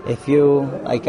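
ordinary talk

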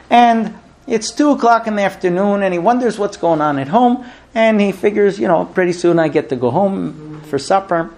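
normal talking voice

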